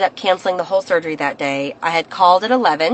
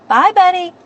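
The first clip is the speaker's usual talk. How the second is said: calling goodbye; 11 semitones higher, 5 dB louder than usual. Real, not acted.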